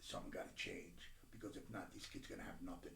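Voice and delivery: worn out, barely getting it out; tired near-whisper; weary low murmur. Real, not acted. Despair before his breaking point.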